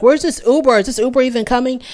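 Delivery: high-pitched, impatient mock yell; retold yell, high and insistent. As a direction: imitate someone yelling impatiently, pitched well up. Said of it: "yelling at him".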